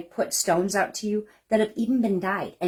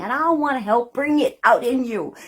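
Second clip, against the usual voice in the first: normal talking voice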